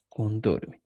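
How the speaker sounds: Contorme, repeated quietly and falling; soft repeat. Shown as a demonstration. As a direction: softly repeat Contorme for the class. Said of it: "le agrego el mi". (Contorme repetido en voz baja)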